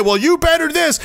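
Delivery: high, sneering mock official voice; a jeering imitation of a politician. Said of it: "when they say".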